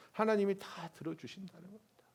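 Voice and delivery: ordinary talk, nothing marked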